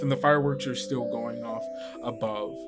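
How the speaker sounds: usual speaking voice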